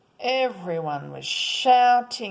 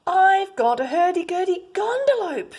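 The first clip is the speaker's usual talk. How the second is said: put-on proud excited child; 9 semitones higher, 6 dB louder than usual. Boy proudly announcing his pet.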